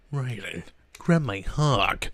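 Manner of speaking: gruff soldier growl, lightly voiced; Solid Snake voice. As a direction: growl it like a grizzled spy. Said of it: "a Solid Snake impression".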